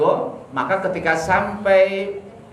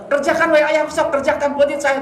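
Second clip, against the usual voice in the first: put-on higher, eager young voice; willing son; 11 semitones higher, faster than usual. Preacher voicing Ismail urging his father, higher and eager. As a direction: sound like a keen boy saying go ahead.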